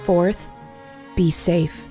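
ordinary delivery

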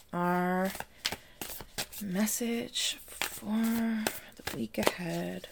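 mystical announcement. Acted. Host opening the weekly reading to listeners, slow and hushed. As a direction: draw it out softly as if revealing fate.

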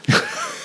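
high burst of real laughter; helpless giggle; laughing. Real, not acted. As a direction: crack up at the memory. Laughing after punchline.

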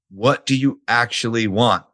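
ordinary delivery

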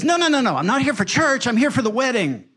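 high, insistent imitation of a woman; mock urgent protest. Bride correcting pastor in parking lot, mock urgent protest. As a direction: rattle off the denials high, then ease down.

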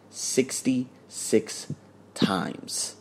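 slow, soft, awed answer; hushed reveal. Real, not acted. Telling viewers how many tries she took, slow and hushed. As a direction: let the number land slowly, hushed.

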